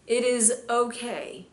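slow, loud, ringing reassurance; preaching. Acted. Preacher assuring the congregation over music, slow and loud. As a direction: lean into each word like a promise.

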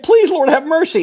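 high pleading whine, mocking the sinner; mock begging sinner. Preacher mocks sinners begging God: high whiny plea. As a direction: beg for mercy in a thin, high mock voice. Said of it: "you're gonna beg".

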